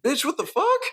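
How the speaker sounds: high-pitched mock disbelief; baffled outburst. Guy answering her weird behaviour, high mock bafflement. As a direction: squeal the question up in imitated shock. Acts out his baffled reply.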